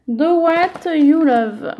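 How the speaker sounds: slow, deliberate Do what you love in a French accent; prononcé lentement avec un accent français; careful French-accented English. Shown as a demonstration. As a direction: read the English phrase slowly with a French accent, unsure of it. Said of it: "je sais pas si ça se prononce comme ça".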